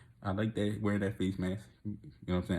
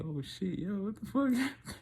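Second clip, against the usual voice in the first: high, slow, amazed; retold astonishment; stunned disbelief; 10 semitones higher, 3 dB quieter, much slower than usual. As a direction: draw it out high in shock.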